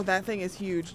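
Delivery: ordinary talk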